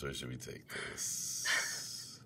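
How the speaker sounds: slow, breathy whispered question. Shown as a demonstration. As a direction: draw it out in a whisper.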